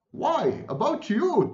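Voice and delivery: high, swooping exclamation; storyteller's gleeful outburst; triumphant exclamation. A narrator's reading. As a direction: announce it in triumph, pitch leaping up. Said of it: "Tweedledee exclaimed, clapping his hands triumphantly".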